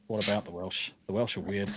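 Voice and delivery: low, quiet pondering aloud; musing to oneself. Real, not acted. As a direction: turn the question over softly, half to yourself. Musing over a question just read out.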